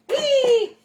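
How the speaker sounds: high, loud, drawn-out whee; playful whee. Pretend. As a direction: squeal it like a kid on a slide. Playful cry while handling the meat.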